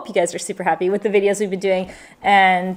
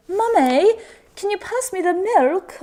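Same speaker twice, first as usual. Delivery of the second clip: put-on high-pitched, slow English child; put-on British little-kid request; English kids' accent; 10 semitones higher, slower than usual. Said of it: "hanging out with all these English kids".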